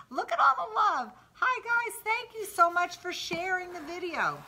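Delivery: excited. Real, not acted. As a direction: squeal with joy at all the love on screen. Excited by hearts flooding the screen.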